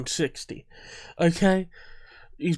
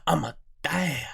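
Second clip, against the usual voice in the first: mock Boston accent. Viewer repeating the dad's line to test it, mock Boston accent. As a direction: put on a teasing Boston accent. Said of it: "like he had a Boston accent".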